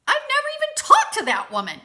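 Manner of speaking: high, loud, fast squawk of disbelief; re-enacted shrill, rushed denial; indignant outburst. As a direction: blurt it out in outraged protest. Retells her indignant reply to rumor.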